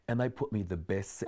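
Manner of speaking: ordinary delivery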